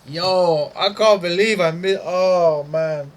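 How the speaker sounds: high, loud cry of disbelief over the missed trade; disbelieving cry. Real, not acted. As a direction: howl it out like you can't believe you missed it. Missed a big trading move.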